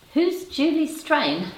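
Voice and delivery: high, slow, puzzled question; genuinely thrown by the name; baffled. Real, not acted. Host puzzling over a chat name, baffled.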